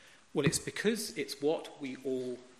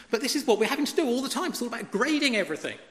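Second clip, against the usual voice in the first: rushed exasperation; 5 semitones higher, 4 dB louder, much faster than usual. Real, not acted. Exasperated about constant grading.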